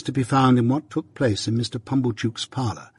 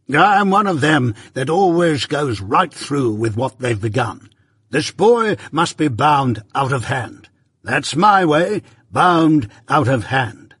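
slow, raised, emphatic pronouncement; pompous Pumblechook, domineering; 3 semitones higher, 4 dB louder, slower than usual. A narrator's reading. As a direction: lay down the law grandly. Voices Pumblechook laying down law.

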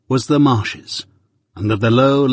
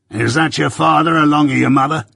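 gruff convict; 4 semitones higher than usual. Acted. Convict questions boy.

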